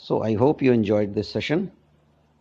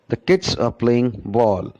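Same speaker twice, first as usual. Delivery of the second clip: slow, with "kids" and "ball" held and "are playing" squeezed; teacher adding words to the same rhythm; stressed kids and ball. Shown as a demonstration. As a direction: keep the beat on "kids" and "ball". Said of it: "We are pronouncing kids and ball".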